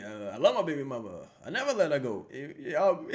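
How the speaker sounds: usual speaking voice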